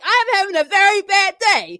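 high-pitched dramatic lament; Stephen A. Smith impression. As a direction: ham up a sports pundit's despair. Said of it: "saw Stephen A. Smith, like".

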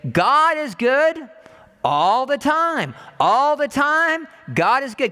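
slow, loud preaching chant; slow loud preacher's call; call and response. Acted. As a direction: proclaim it slowly to the congregation. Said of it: "Can you say it with me?".

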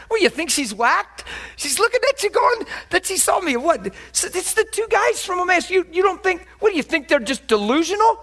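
incredulous rebuke. Retold or imitated. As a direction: shake them out of unbelief. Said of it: "He said".

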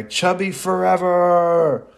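high, loud, drawn-out battle cry; hammed-up triumphant bellow, slowly; yelled to the sky. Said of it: "yells to the sky".

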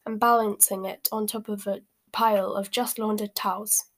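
ordinary delivery, nothing marked

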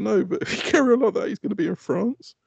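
high, teasing threat; mock warning. Father jokingly warns son the hamster will end up in France. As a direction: jokingly warn the kid.

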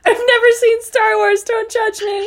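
high loud tearful laughter; crying with laughter. Real, not acted. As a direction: laugh till you cry. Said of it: "I'm crying".

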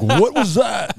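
a booming mock Norma bursting through the door; large man voice. Imagining Norma bursting in, mock big-man bellow. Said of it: "made her sound like a large man".

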